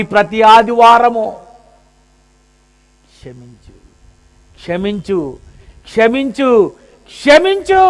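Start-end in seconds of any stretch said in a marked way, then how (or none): 3.2-7.8 building plea. Pretend. Blood to God every Sunday, plea building to a cry. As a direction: start hushed and build each plea.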